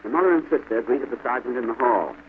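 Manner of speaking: ordinary delivery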